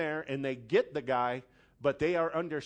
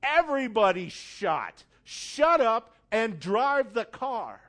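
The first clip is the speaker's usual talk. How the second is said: louder, slow barked order: shut up and drive; a captain barking at a wounded driver, louder and slow; impatient captain's shout, louder and slowed; gruff order; 5 semitones higher, 5 dB louder, slower than usual. Retold or imitated. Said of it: "he says".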